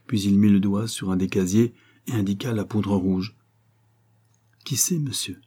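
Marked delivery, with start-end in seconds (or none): 4.6-5.4 uneasy. A narrator's reading. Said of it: "agité d'un pressentiment".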